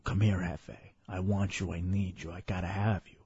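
low, soft, seductive hint of an Italian accent; mock Italian accent. Host imitating his dream woman calling him to bed, low mock Italian accent. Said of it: "talking in her Italian accent saying".

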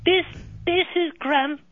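a lightly voiced British little boy; British child voice. British-accented son on the phone asking for his daddy. Caller plays her son Graham.

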